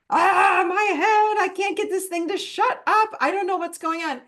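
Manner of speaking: high, loud frustrated wail, half-acted; mock overwhelmed cry. Speaker acts her overwhelmed self cooking dinner, frustrated cry. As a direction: groan out a racing mind at the stove. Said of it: "I'm like".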